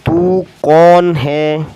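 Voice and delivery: teacher reading a sentence slowly. Shown as a demonstration. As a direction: read it out slowly word by word. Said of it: "आप सुने इसे".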